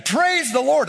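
high, loud urging call. Retold or imitated. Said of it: "Third time".

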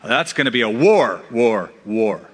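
first "war" punched, the next two dropping away; "war, war, war" in separate weighty falls; emphatic repetition. Shown as a demonstration. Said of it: "It's there for emphasis".

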